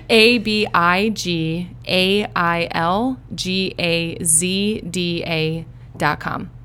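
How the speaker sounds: slow, letter by letter spelling; spelled out. Shown as a demonstration. Guest spells her website for listeners, slow and clear. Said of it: "so I'm gonna actually spell it out".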